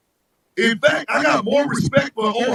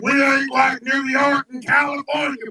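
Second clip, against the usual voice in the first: raised, defiant voice of a boastful underdog; boastful Mississippi; 4 semitones higher than usual. Acted. Mississippi talking above its weight class, boastful. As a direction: brag like a cocky small state. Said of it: "Mississippi like".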